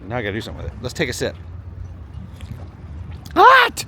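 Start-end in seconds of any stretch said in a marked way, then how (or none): 3.3-3.8 sudden high, loud yelp; burned yelp. Real, not acted. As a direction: yelp as the hot sip burns. First sip of hot coffee.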